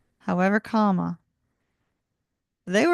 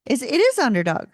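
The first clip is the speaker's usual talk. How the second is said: normal talking voice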